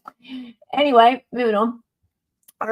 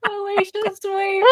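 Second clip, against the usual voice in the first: high mocking; 14 semitones higher than usual. Mocking the name.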